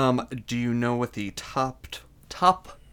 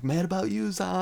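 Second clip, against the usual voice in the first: mock yell; 7 semitones higher than usual. Susan calling to George in the retold episode, mock yell. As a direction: call it out higher, rising, without real volume. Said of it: "Susan yells to him".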